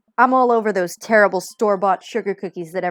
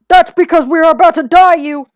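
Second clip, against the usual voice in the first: panicked scream; 9 semitones higher, 14 dB louder than usual. Acted. Trucker screams at his brother.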